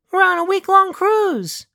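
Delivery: high, slow woman's voice; high female voice. A narrator's reading. Elena's voice.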